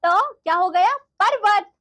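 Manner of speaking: normal talking voice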